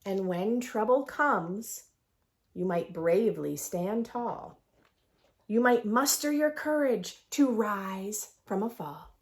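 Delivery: lively sing-song picture book read; reading to children. A narrator's reading. Teacher reads the rhyming book to her class. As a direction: read the rhymes aloud with bounce.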